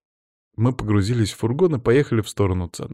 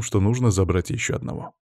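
ordinary delivery, nothing marked

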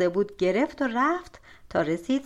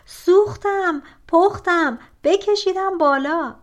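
higher, louder cry of burning pain; shouting in pain; 8 semitones higher, 6 dB louder than usual. A narrator's reading. Brother in the well shouts to be pulled up, loud cry. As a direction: yell up the well as if scorched. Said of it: "داد زد".

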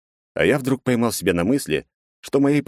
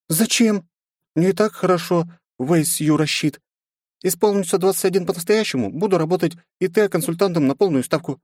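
put-on high young girl voice; girlish voice; 11 semitones higher than usual. A narrator's reading. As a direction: pitch up as a pouty teen. Leni's voice in narration.

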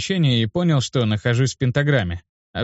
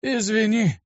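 put-on higher-pitched old man's sad apology; 10 semitones higher, slower than usual. A narrator's reading. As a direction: apologise sorrowfully, without pushing the volume. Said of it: "грустно ответил мне старик". (грустно, с сожалением)

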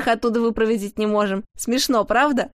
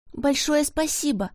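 a higher, heartfelt thank-you; grateful and remorseful; 5 semitones higher than usual. Acted. Pavlik thanking the jesters, grateful and remorseful. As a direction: thank them sincerely, a bit ashamed. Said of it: "с чувством благодарности и раскаяния".

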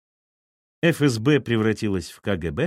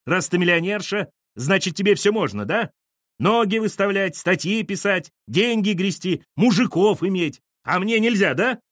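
spiteful mockery; 8 semitones higher than usual. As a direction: jeer at her with envy. Lyosha mocking her. (язвительная издёвка)

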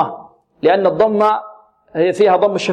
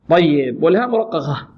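ordinary talk

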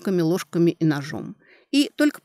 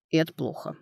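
softer, subdued ending; far from joyful; 6 dB quieter than usual. A narrator's reading. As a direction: drop abruptly to a downbeat note. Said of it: "отнюдь не на радостно восторженной ноте". (внезапно тише, совсем не радостно)

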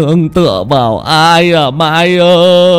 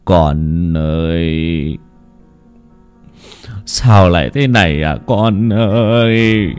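grieving reproach; 4 semitones higher, slower than usual. Acted. Old father wailing reproachfully over his dead daughter. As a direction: mourn and blame the dead daughter. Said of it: "thẫn thờ mà nói như là oán, là trách".

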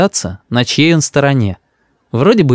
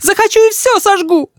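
very high-pitched angry outburst; defiant cry. A narrator's reading. Mother retorts to grandmother through door, furious. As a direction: fling it out in defiance. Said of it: "кричит мама".

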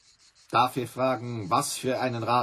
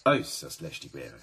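pointed low insinuation; 7 semitones lower than usual. Acted. Manager hints darkly at suspicion.

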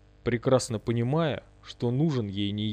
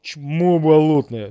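slow, loud, raised-pitch jeer; loud insult; 4 semitones higher, 8 dB louder, much slower than usual. A narrator's reading. Бурый insults the ghost, loud contempt. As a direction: stretch the insult out at full voice. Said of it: "сказал мне Бурый".

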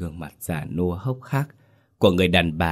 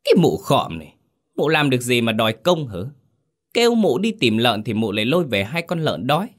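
contemptuous taunt; 6 semitones higher than usual. Acted. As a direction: berate the old woman with scorn. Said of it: "giọng nhạt thách".

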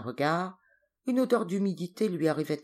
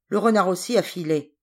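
gruff displeased remark, a bit louder; 4 semitones higher, 8 dB louder than usual. A narrator's reading. Hunter grumbles to the girl, displeased and gruff. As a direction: grumble that the fox got away. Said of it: "dit l'homme d'un air mécontent".

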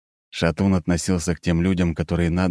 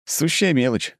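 wry scoff; 8 semitones higher than usual. A narrator's reading. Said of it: "хмыкнул я".

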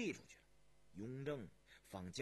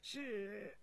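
high, slow palace servant; high, drawn-out deferential voice; servant revealing bad news; 5 semitones higher, slower than usual. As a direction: confess it haltingly to the emperor.

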